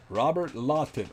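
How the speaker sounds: Robert Lawton with an accent. Shown as a demonstration. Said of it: "I gotta say it with the accent".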